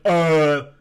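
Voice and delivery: lightly mock the smug internet guy; mocking a clueless commenter. Streamer mocking chat commenters, a loud dopey put-on voice. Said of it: "and they're like".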